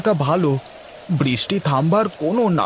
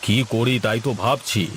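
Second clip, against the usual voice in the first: lower, heavier landlord voice; zamindar voice; 6 semitones lower, 3 dB quieter than usual. Acted. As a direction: speak down as the village landlord. Same actor voices the zamindar.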